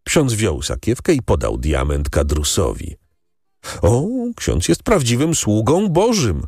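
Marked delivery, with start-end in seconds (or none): 3.8-6.4 higher, mildly exclaimed praise; understated cry of admiration; exclaiming. A narrator's reading. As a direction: exclaim it with a lifted pitch, without shouting. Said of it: "wykrzyknął Kadrus".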